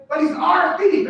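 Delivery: high, loud and slow, owning it; a crowd leader's boast; proud shout. Retold or imitated. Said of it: "You know what he said?".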